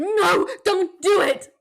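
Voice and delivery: mock barbarian. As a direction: yell like a girl clinging to your back. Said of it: "like a barbarian".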